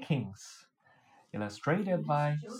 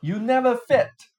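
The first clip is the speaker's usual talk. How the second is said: teasing; 7 semitones higher, 10 dB louder than usual. A narrator's reading. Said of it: "The fisherman laughed".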